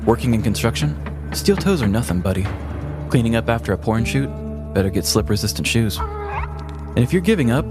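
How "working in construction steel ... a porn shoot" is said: ordinary talk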